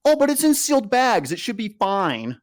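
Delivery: high, breezy imitation of superiors; dismissive mock reassurance. Superiors telling soldiers the moldy rations are okay, mock reassurance. Mimics superiors brushing off mold.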